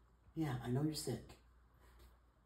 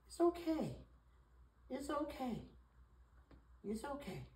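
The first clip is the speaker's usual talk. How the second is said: cooing reassurance; 10 semitones higher, 7 dB quieter than usual. Real, not acted. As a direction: soothe a pet in a high coo, fading softer. Comforting the crying cat.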